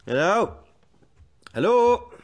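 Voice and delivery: loud, high call through the house; loud questioning hello into the house. Acted. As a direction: call out to see who's home. Howl calls into empty house.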